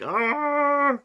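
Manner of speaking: sudden high anguished yelp; anguished cry. Real, not acted. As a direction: cry out in dismay at the dice. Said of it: "I'm getting emotional here".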